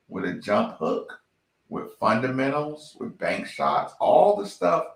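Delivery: usual speaking voice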